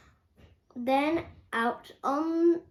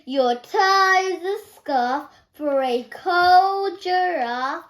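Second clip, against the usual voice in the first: lively rhyme reading; 5 semitones higher, 7 dB louder than usual. A narrator's reading. George reads the animals' card aloud, bright and loud. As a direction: read the rhyme higher and louder, bouncing.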